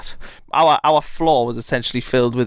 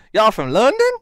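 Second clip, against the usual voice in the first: American counsellor puzzled; 9 semitones higher, 3 dB louder than usual. Retold or imitated. Counsellor mishearing Newcastle.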